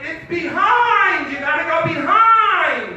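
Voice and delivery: high, loud drawn-out holler; calling back. Retold or imitated. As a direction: imitate mom shouting directions through the house. Mom calls back up to him.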